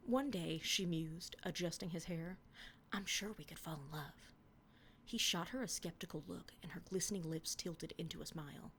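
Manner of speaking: earnest romance reading; dramatic reading of teenage prose; story reading. Acted. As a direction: read the fanfic with mock tenderness. Reading her old fanfic aloud.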